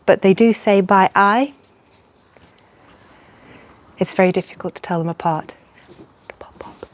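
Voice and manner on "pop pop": soft unvoiced call; whispering. Real, not acted.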